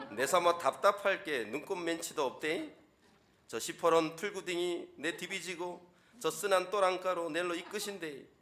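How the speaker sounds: Gyeongsang dialect. Shown as a demonstration. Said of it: "경상도 버전은요, 약간 한 톤을 좀 높여야 돼요".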